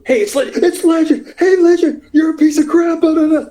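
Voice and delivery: high, loud imitation of jeering fans; mock a rowdy bunch yelling from the aisle; heckling fans. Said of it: "a bunch of them go".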